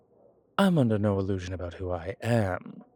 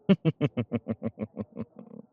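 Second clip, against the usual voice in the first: rolling chuckle that tapers away; long chuckle; 3 semitones higher, 4 dB louder than usual. Acted. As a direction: chuckle on and on, letting it fade. Amused by improper body disposal.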